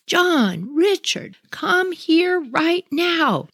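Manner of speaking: high, slow, stern call of the full name; stern mom call. Retold or imitated. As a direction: draw out each name like a mother who caught him. Mom calls son in anger.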